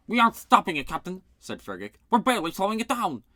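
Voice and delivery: alarmed shout. A narrator's reading. Fighting monster, alarm.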